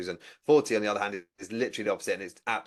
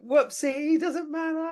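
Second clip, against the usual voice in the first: much higher, sing-song "whoopsie"; cheery whoopsie; 4 dB louder, much slower than usual. Retold or imitated. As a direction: chirp it brightly like nothing happened. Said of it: "I'm very much like".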